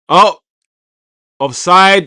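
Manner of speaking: loud, high match-day outburst; shouted reaction. Real, not acted. Watchalong host spotting an offside, shouted reaction. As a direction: burst out loudly at the call.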